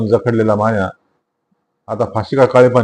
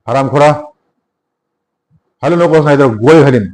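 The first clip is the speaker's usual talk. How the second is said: louder threatening shout; 3 semitones higher, 4 dB louder than usual. A narrator's reading. Inspector outshouts Bali, threatening to shoot. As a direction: bark the threat louder, higher. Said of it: "त्याहीपेक्षा मोठ्याने आवाज करून म्हणाला".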